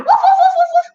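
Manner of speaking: rapid high, loud woofs; high-pitched volley of barks; frantic barking. Acted. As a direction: bark like an overexcited little dog. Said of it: "the dog going".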